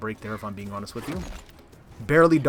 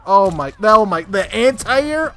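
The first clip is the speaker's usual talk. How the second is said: loud, slowed stunned "oh my"; stunned disbelief; 6 semitones higher, 6 dB louder, slower than usual. Real, not acted. Streamer watching his loss replay, stunned and loud. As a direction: drag out the shock at the anti-air. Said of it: "reacting to opponent's anti-air".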